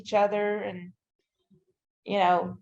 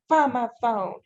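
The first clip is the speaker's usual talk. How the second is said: mild urgent mock yell; half-raised frantic order, retold; retold scream; 3 semitones higher than usual. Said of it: "I would scream at Jess".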